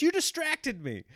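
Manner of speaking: high, whiny blaming imitation; mock wife voice. Husband mimicking wife blaming him, high and whiny. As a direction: hand the blame back, mock-whiny.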